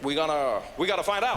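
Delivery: halting presidential impression; measured statesman, pausing and restarting; Barack Obama. President responding to the shot-down plane, halting resolute impression. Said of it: "It's a great Obama accent".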